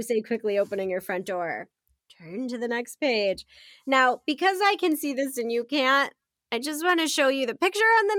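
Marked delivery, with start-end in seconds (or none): none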